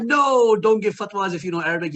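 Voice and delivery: high, exclaimed joking objection; mock alarm. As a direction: blurt a loud, high "No" in playful protest. Mock alarm at a joke answer.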